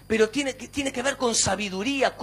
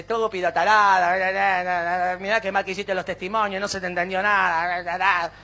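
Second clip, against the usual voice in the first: lightly voiced nagging wife, lower; 4 semitones lower than usual. Wife criticising her husband's testimonies, mildly mocked nagging. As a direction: run the scolding together into mock blather.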